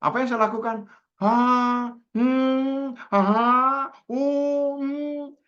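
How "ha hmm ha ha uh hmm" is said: high, drawn-out hums and uh-huhs; exaggerated listening noises. Therapist refuses to feed friend's misery, high noncommittal hums. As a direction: respond with hammed-up noncommittal noises.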